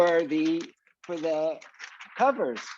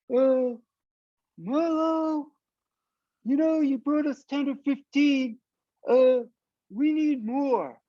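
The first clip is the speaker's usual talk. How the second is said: lightly higher voice for Ted, slow at the end; a hint of the caller asking for more; retelling Ted's call in a raised voice; voicing Ted; 8 semitones higher than usual.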